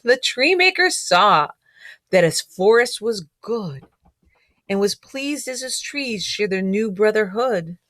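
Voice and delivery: storybook read-aloud, gently rhythmic; reading to children. A narrator's reading. Teacher reading a picture book rhyme to children.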